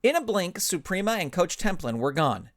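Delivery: ordinary delivery, nothing marked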